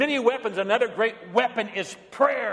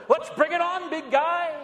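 much higher, cocky mock challenge to the dog; mock bravado; 10 semitones higher than usual. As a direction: puff up and dare the big dog, half joking. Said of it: "Did I say".

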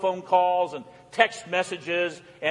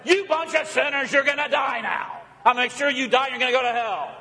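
higher, half-hearted mock yell of an angry God; hammed-up fire-and-brimstone rant, a bit higher; 6 semitones higher than usual. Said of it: "yell and scream from heaven".

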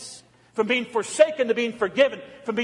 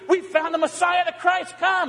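much higher, eager announcement; excited Andrew; 11 semitones higher, 4 dB louder than usual. Acted. Andrew running to tell Peter, high eager excitement. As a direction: burst out with the good news. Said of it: "He runs out and finds Peter".

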